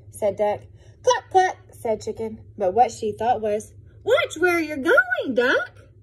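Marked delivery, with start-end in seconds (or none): 1.0-1.6 high, loud cluck cluck; playful chicken clucks; clucking hen. Acted. 4.0-5.9 high, loud and slow warning; a hen telling Duck off; fussy chicken, much higher; scolding chicken. Acted.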